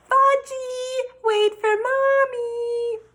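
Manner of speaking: put-on high, loud mother calling after her toddler; hollering. Mother chasing her little boy through the park, hollering high and loud. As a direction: yell for the runaway child, stretched out.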